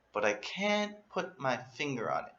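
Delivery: usual speaking voice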